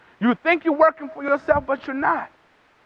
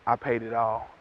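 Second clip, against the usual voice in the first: much lower and softer, solemn and steady; quiet pleading advocate; 13 semitones lower, 9 dB quieter than usual. Acted. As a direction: say it low like a final verdict of love. Advocate pleading before God.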